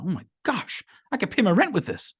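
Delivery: high, softened gasp of mock amazement; so excited. Poor person finds a thousand dollars, acted excitement. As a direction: act out thrilled disbelief in a high voice. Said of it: "he's so excited".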